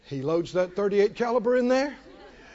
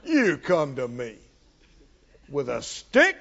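low, laughing giant's taunt; mocking giant; 5 semitones lower than usual. The Philistine laughing at David's stick, low taunt. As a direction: scoff at the boy's stick. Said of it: "And the Philistine said to David".

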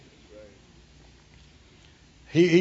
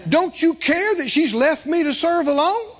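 indignant Martha; 6 semitones higher than usual. Retold or imitated. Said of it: "she's talking to Jesus".

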